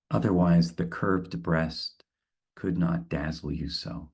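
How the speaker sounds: unhurried recitation of the poem; poem reading. Acted. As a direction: read it as a solemn poem, line by line. Reads the Rilke poem aloud.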